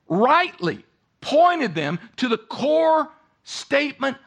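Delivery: impassioned sermon delivery; preaching at full force. Acted. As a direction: preach it out with rising fervor. Preacher voices Jesus' claim.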